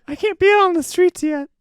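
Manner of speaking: put-on very high, loud kid voice; mock child protest, squeaked loudly; nine-year-old action hero. Host as the child star kept indoors, loud squeaky kid. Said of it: "Little nine-year-old".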